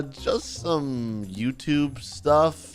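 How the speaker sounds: slow, halting, reluctant reply; hesitant answer. Acted. Victim telling the bully his work, hesitant. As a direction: drag it out sheepishly.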